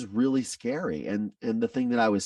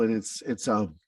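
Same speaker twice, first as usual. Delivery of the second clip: ordinary talk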